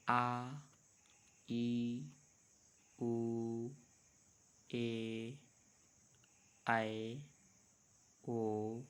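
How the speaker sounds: teacher stretching every long vowel for the class; drawn-out long vowels. Shown as a demonstration. Teacher to students, demonstrating long vowels, each one held out. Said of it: "उच्चारण में ह्रस्व स्वर से थोड़ा ज़्यादा समय लगता है".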